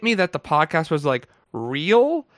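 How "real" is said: ordinary talk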